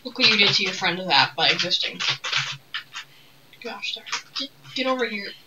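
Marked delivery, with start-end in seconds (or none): 3.6-4.2 unvoiced whisper of frustration; whispered curse. Real, not acted. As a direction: breathe the curse out under your breath. Muttered frustration at game.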